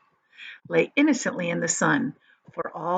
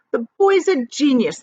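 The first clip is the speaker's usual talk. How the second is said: eager announcement, higher and louder; announcing; 6 semitones higher, 6 dB louder than usual. A narrator's reading. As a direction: proclaim it proudly. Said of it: "the centipede announced".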